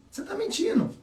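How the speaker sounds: teasing you're lying; mock accusation. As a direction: catch them out in a playful high voice. Imitating expert reading a liar.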